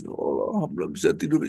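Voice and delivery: high-pitched imitation of a weeping wife; tearful thanksgiving. Wife relieved her husband sleeps, imitated tearful thanks. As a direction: mildly imitate crying with relief. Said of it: "Istrinya nangis".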